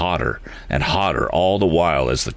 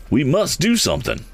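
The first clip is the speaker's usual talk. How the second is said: cried; 9 semitones higher than usual. A narrator's reading. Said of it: "cried Mister Damon".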